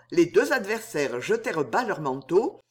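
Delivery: ordinary delivery, nothing marked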